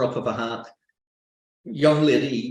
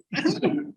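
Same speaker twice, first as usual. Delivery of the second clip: nervous high squeak. Shown as a demonstration. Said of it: "that very strange thing that nerves do to the voice".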